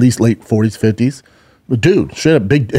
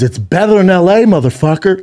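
retold loud heckle, pitched up; 6 semitones higher than usual. Heckler talking back to the roasting comic, loud. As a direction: yell it back like a heckler, half-strength.

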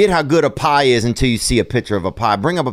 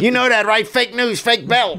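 much higher mimicked catchphrase; Michael Rapaport; 10 semitones higher than usual. Host hammers a celebrity's catchphrase at his co-host, high mimicking voice. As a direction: push the borrowed catchphrase way up in pitch. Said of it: "That's that Michael Rappaport, huh".

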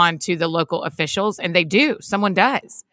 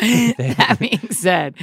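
very slow copycat phrasing; mimicking drawn-out pivot; 2 semitones higher, much slower than usual. Host echoes co-host's pivot, drawn out. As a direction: echo it back, dragging every word.

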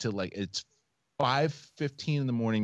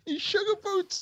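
sheepish excuse. Retold or imitated. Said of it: "And I'm like".

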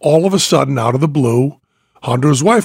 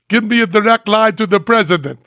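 mock wife voice; 6 semitones higher, faster than usual. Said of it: "she says".

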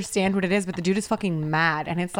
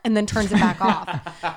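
ordinary talk, nothing marked

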